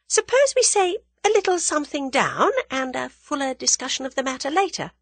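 high-pitched, composed proposal; smooth negotiating heroine. A narrator's reading. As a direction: float the offer delicately in a high voice. Voices the cool heroine.

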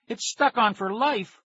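high, drawn-out lament; despairing wail. A narrator's reading. Boy with phone glued on for good, despairing wail. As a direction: wail it slowly, doomed for ever.

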